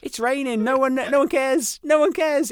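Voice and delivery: comic surrender in a high voice; high resigned shrug. Retold or imitated. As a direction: toss it off as if nothing matters anymore.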